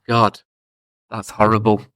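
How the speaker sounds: normal talking voice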